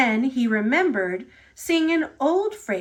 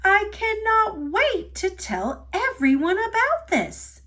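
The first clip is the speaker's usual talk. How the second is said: eager; 9 semitones higher than usual. Acted. Thomas eager to tell friends.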